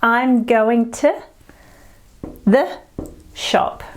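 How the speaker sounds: "I'm going to the shop" broken up slowly, "shop" breathed; slow, word by word, to show the schwas; slowed demo. Shown as a demonstration. Teacher slowing the sentence for learners. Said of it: "Can you hear them?".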